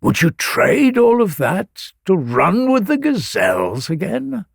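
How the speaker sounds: high, impassioned remonstrance with sweeping pitch; calling down. A narrator's reading. Sun god defends the priestess from the heavens, calling down. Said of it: "the sun god called down to him".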